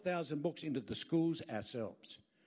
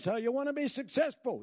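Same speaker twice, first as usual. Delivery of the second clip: higher, louder drill-sergeant challenge; American general; 7 semitones higher, 4 dB louder than usual. Retold or imitated. General quizzing his audience, higher and louder. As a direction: bark it like a general rallying troops. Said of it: "I saw this American general".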